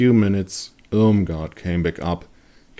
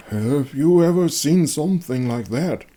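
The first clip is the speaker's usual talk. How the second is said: raised, higher voice of a worried villager; Conrad's voice; 9 semitones higher, 4 dB louder than usual. Acted. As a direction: ask it high and anxious. Conrad asks the hunter.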